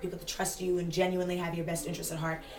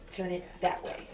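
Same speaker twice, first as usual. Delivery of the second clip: ordinary delivery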